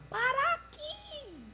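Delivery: high, drawn-out calling out to a car. Retold or imitated. Roadside woman calls to the passing car, high and drawn out. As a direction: flag down the car with a long high call. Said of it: "estendia a mão e dizia".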